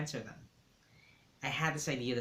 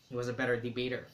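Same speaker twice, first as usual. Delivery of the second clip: ordinary delivery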